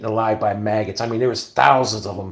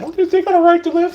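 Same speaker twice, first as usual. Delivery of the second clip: much higher mocking whine; 4 dB louder than usual. Mocking people who feel sorry for criminals, high sneering voice. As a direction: mimic the bleeding hearts in a silly high voice.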